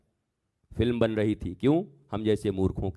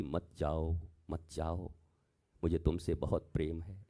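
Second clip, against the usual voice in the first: tender plea; 4 semitones lower, 5 dB quieter than usual. Retold or imitated. Shiva pleading tenderly with Sati.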